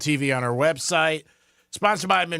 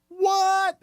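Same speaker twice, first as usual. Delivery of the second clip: sudden high "What?"; mock surprise. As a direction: jump up in fake disbelief. Mock disbelief at Gators win.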